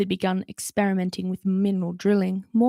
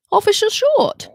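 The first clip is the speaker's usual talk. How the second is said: much higher, louder whine of a caught thief; whining dwarf; 14 semitones higher, 8 dB louder than usual. Acted. Mulch the dwarf whining at Holly, high and loud. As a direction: whine her name like a child caught out. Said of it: "he whined".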